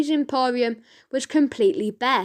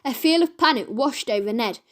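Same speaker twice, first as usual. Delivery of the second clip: usual speaking voice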